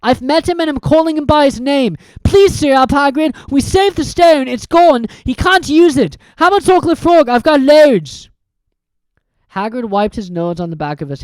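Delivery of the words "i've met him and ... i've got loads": put-on louder, higher boy; Harry's voice. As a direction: coax him to cheer up. Harry cheers up Hagrid.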